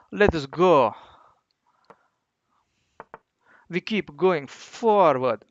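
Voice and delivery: rallying cry in a put-on Russian accent. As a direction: slip back into a booming Russian accent. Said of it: "Again, what's wrong with me?".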